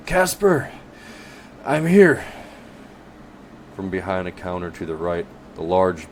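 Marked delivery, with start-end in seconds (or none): 0.1-2.2 high, loud and out of breath; breathless call. Acted. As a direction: call out, panting. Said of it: "He calls out breathlessly".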